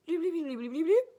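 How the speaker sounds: high mock bleeps; mock bleeping. Teller mimicking her boyfriend switching personalities, high bleeps.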